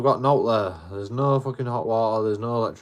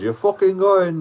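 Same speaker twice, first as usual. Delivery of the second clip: much higher, louder mock yell; landlord insisting; 10 semitones higher, 7 dB louder, slower than usual. Landlord insisting the tenant leaves, loud mock yell. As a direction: insist he's leaving.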